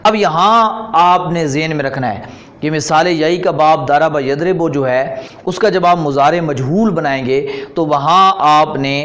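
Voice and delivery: normal talking voice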